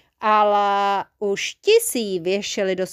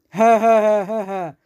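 loud villain laugh; victorious acted snicker; triumphant cackle; 6 dB louder than usual. A narrator's reading. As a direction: gloat with a monster's cackle. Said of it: "ozvalo se vítězně spod týpí".

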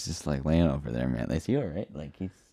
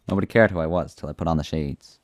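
usual speaking voice

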